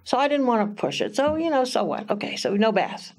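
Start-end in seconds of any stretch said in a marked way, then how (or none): none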